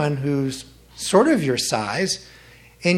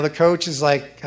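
ordinary talk, nothing marked

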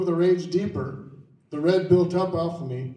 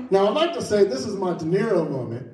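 usual speaking voice

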